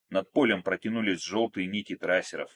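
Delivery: ordinary delivery, nothing marked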